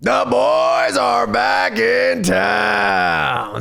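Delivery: very slow, high, shouted announcer call; bad acting. Shown as a demonstration. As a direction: stretch it out like a ring announcer. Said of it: "You gotta deliver it just like that".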